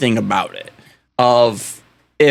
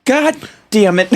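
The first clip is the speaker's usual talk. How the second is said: mock exasperation; 9 semitones higher than usual. Britain and France thwarted over slavery, mock exasperation. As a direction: curse in playful defeat, pausing midway. Acts foreign powers' frustrated reaction.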